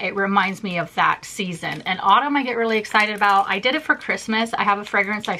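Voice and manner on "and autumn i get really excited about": ordinary talk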